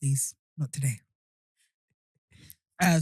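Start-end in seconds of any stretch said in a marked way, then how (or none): 0.0-1.0 weary hungover refusal. Real, not acted. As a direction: push it away, too hungover. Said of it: "I am just hungover".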